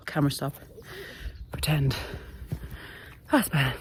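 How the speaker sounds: out of breath on a steep climb; puffing between words while hiking uphill; heavy breathing. Real, not acted. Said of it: "Bit of heavy breathing going on here".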